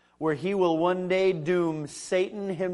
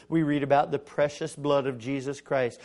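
usual speaking voice

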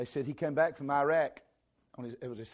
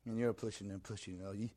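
ordinary talk, nothing marked

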